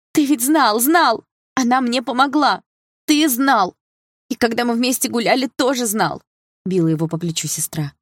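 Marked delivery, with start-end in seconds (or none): 0.1-6.2 high, loud accusation; furious outcry. A narrator's reading. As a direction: hammer out each reproach in a high, raised voice. Sister hits and accuses brother.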